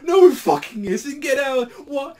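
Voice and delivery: shrieking high, loud indignant rant; angry Gintoki. Retold or imitated. Gintoki yelling at the singing idol, shrill high outrage. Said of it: "fucking Gintoki's like".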